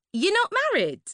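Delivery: put-on high, breathy-bright posh young woman; a high, gushing party girl; Suzanne's gushing voice. To Laurie at the party, a high gushing voice.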